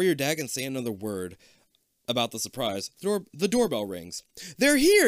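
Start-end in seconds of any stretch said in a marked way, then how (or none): 4.6-5.1 high, loud singsong call. A narrator's reading. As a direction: mom calling through the house. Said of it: "your mom calls from the front hall".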